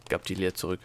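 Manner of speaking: ordinary delivery, nothing marked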